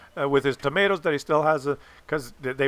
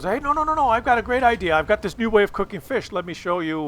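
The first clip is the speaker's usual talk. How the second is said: higher, eager dad voice bursting with an idea; imitating excited dad; 4 semitones higher than usual. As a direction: play the dad who can't wait to share his trick. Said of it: "my dad says".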